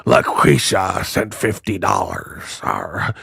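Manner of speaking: low, growly mock pirate. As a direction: play it like a pirate. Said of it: "She talked like a pirate".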